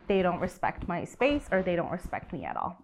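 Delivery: ordinary delivery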